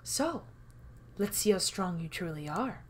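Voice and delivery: hushed, unnervingly coy challenge; soft-spoken, coy game host; coy, masterful. A narrator's reading. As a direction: toy with the listener softly and masterfully. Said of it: "An unnervingly coy, masterful tone drips through the host's voice".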